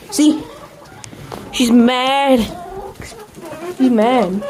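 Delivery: usual speaking voice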